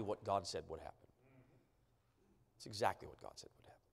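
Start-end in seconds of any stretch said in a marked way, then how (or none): 2.6-3.8 much softer and quicker, almost under the breath; hushed repeat. Real, not acted. Preacher repeating to the congregation, hushed and rapid. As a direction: drop to a near murmur, quickly.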